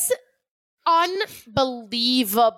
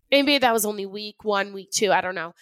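ordinary delivery

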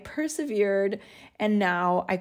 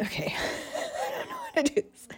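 laughing, much higher pitch; real laughter breaking through the words; 6 dB quieter than usual. Real, not acted. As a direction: break up laughing mid-sentence.

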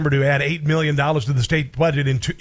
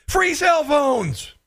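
high, slow incredulous shout; mock outrage; 11 semitones higher, much slower than usual. Real, not acted. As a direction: drag it out in disbelief.

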